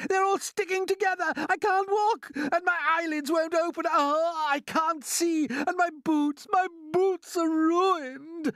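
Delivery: very high, loud, outraged wailing; angry screaming. A narrator's reading. Paint-covered centipede rages at his ruined state, screaming. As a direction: scream each complaint, stretching the last words out. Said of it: "The centipede screamed with anger".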